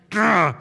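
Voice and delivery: loud playful grr growl; mock-angry growl. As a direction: growl your frustration at God. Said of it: "he's mad at God".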